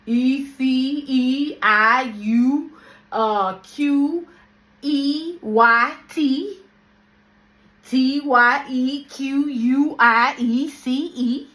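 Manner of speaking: spelling the name backwards, letter by letter; slow, loud letters read back to front; backwards speech. Shown as a demonstration. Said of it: "They've taught me how to say it backwards".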